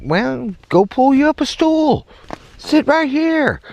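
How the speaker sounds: very high-pitched mock innocence; high singsong offer; cheeky invitation. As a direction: invite them over in a high, held-up voice. Cheeky invitation to coworkers, retold.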